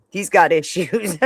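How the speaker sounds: normal talking voice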